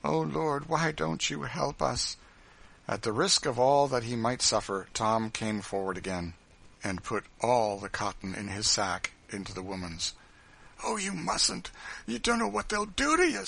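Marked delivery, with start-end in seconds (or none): 0.0-2.2 higher, pleading lament; drawn-out, suffering cry for help; despairing prayer. A narrator's reading. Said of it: "she was evidently praying in suffering". 10.8-13.4 high, worried woman's voice; alarmed protest; anxious warning. A narrator's reading. Woman anxiously warns Tom.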